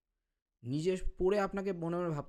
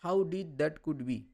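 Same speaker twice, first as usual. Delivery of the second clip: slow literal reading; much slower than usual. Shown as a demonstration. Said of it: "word by word translation করা হয়".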